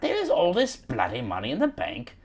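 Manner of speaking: high-pitched British movie gangster; hammed-up British heist villain; British criminal. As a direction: demand it in a theatrical British crook accent. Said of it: "criminal British guys".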